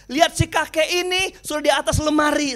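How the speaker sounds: usual speaking voice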